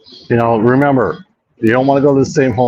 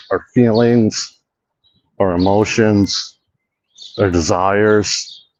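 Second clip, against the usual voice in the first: slow mock-serious; 2 semitones lower, much slower than usual. Slowing down listing emotions.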